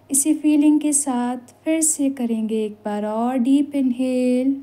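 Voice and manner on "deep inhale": very slow, easing down on the last words; slow breathing cue. Shown as a demonstration. Guide leading one more breath into relaxation, slow breathing cue. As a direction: draw out each word, then sink into relax. Pacing breath, then letting go.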